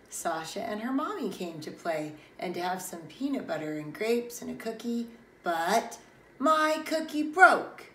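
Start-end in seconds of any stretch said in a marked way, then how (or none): 6.4-7.9 high, loud, slow lament; wailed complaint. Acted. Child mourns a broken cookie at lunchtime, wailing. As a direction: wail it like a small disaster.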